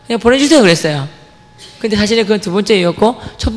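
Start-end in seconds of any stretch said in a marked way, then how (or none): 0.1-0.6 higher-pitched plea to father; raised, imploring mock request; retold pleading. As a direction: act out begging dad for permission.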